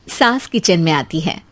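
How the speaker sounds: plain reading voice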